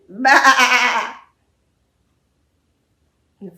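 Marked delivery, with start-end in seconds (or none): 0.1-1.2 loud bleat from the rooster; sheep bleat. Acted. Storyteller voices the rooster bleating at the farmer. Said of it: "the rooster looked at him and went".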